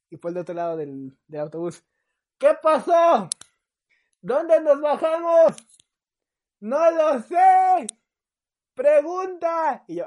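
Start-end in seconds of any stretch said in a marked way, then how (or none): 2.4-9.8 loud high call, slow and drawn out; distant shouting. Retold or imitated. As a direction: shout back from the far end of the bus. Paul shouts from other end. (gritos lentos desde lejos)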